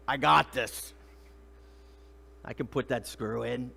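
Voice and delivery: low-pitched macho boast, hit hard then easing off; puffed-up mock bravado; macho confidence. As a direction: play the capable tough guy. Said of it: "we get the macho in us".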